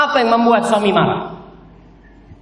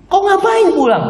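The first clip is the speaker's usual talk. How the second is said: high-pitched, acted disbelief; incredulous friend; 12 semitones higher, 3 dB louder than usual. Friend asking husband why go home, incredulous. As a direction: question him with a big jump in pitch.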